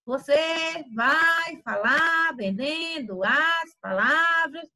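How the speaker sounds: very slow, singsong stretched syllables; exaggerated word-selling. Shown as a demonstration. Teacher shows students how not to talk, in exaggerated singsong drawl. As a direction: draw out every word like a hawker's chant. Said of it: "você não vai estar falando assim".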